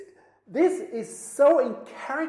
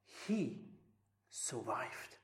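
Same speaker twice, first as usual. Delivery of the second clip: hushed reveal; 11 semitones lower, 14 dB quieter, much slower than usual. Real, not acted. Sermon climax, hushed.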